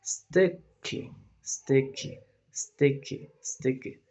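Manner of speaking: "Sticky" repeated with short i, fading at the end; model word. Shown as a demonstration. Teacher drilling the word for students. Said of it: "como segunda sílaba".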